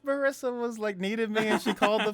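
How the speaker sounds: high, shrill-sounding mock of an angry mom; imitating mom yelling. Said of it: "she's just yelling".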